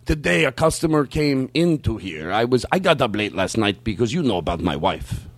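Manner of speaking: slow impression with accented wording; imitated acquaintance's voice. Man confides in his regular about a customer, slow imitated voice. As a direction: imitate him slowly, word by word. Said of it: "do him doing that voice".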